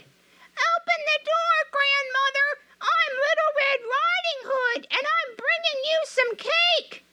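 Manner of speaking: very high put-on little girl voice; a wolf calling in a falsetto girl's voice; wolf faking a girl. A narrator's reading. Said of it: "the wolf knocked and cried".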